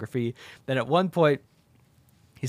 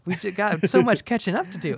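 put-on much higher, sugary girlish voice; gushing Denise; 8 semitones higher than usual. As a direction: gush over an old flame. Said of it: "And it's like".

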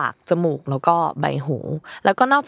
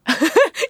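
high burst of laughter; 12 semitones higher than usual. Real, not acted. Laughing at the question.

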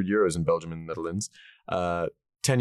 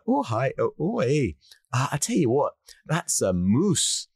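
higher-pitched, hammed-up Canadian; Canadian accent; 6 semitones higher, slower than usual. Bartender explains to the Scotsman, put-on Canadian accent. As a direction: play the Canadian bartender, drawing out the last words. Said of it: "Apologies for the Canadian accent".

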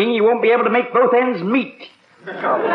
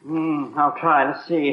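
ordinary delivery

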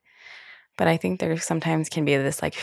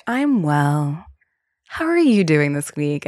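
therapist imitated; 4 dB louder, slower than usual. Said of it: "she's like".

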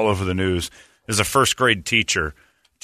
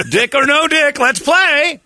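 game show host; 5 dB louder, slower than usual. Pretend. Mock game show wheel spin.

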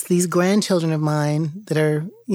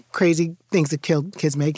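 ordinary talk

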